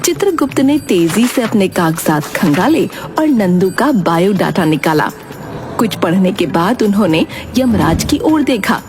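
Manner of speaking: ordinary talk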